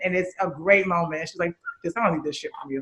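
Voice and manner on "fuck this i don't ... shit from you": muttered much softer, under the breath; host re-enacting Whitney's line, muttered under the breath; quoted under the breath. Quietly muttering the movie line, nearly to herself. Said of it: "like, under the breath".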